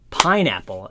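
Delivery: PINE-apple, first syllable punched loud; stress on first beat. Shown as a demonstration. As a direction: hit PINE, let "apple" fall away. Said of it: "accents the first beat".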